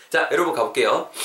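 ordinary talk

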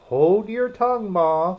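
high, loud snappy retort; snappish. A narrator's reading. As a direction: snap at your mother. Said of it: "a little snappishly".

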